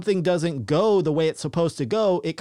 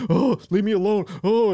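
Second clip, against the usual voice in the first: mock scared plea; 4 semitones higher than usual. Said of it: "most people probably would have went".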